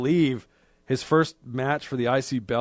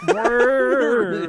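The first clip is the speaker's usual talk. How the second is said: laughing; 9 semitones higher, 4 dB louder, much slower than usual. Real, not acted. Laughing high voice.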